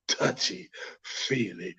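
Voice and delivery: slow, low, very soft whisper; creepy whisper. Acted. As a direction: whisper it slowly to creep him out. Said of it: "really, really creepy".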